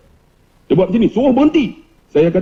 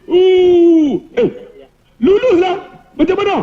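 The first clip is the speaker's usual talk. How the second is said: high, drawn-out "ooh" then eager question; amazed; 9 semitones higher, slower than usual. Acted. Colleagues see the approved leave form, high amazed voices. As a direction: squeal it in mock amazement.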